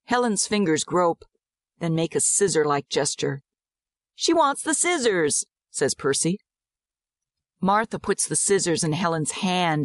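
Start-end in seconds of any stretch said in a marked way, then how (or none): 4.2-5.5 put-on high-pitched little boy; child's voice. A narrator's reading. Servant's son reading the girl's gesture, childlike. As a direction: pipe up like an eager small boy.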